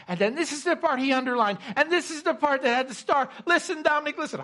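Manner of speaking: urgent, high, rapid build; acting out her excited shout down the phone; retold near-shout. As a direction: insist loudly that the listener pay attention. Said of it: "she almost shouted it at me".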